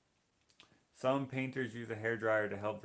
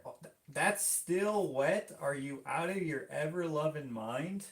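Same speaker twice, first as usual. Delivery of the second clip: mock outrage; 6 semitones higher than usual. Painter scolding over still-wet paint, mock outrage. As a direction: exclaim as if scandalised, pitch swooping up.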